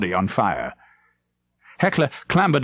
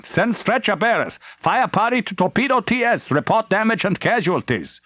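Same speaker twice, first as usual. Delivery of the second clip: taut orders; 10 semitones higher than usual. Acted. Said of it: "taut replies".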